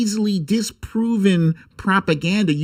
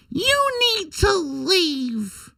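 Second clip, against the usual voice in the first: high mock dismissal; much slower than usual. Telling Chuck Todd to go.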